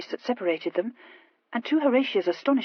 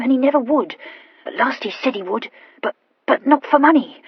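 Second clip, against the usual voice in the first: higher, louder, stumbling over consonants; stammering Horatia; 3 semitones higher, 8 dB louder than usual. Acted. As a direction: confess eagerly with a nervous stammer. Horatia tells her story.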